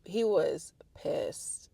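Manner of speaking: whispered aside, slow; hushed slow confiding. Real, not acted. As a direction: drop to a whisper to share it. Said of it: "whispered aside".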